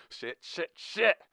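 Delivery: high, frantic swearing building to a shout; panicked cursing. A narrator's reading. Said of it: "he hissed as the knob refused to budge".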